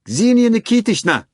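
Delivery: high, loud piercing call; piercing shout. A narrator's reading. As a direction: shout her name across the lobby. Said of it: "he shouted piercingly".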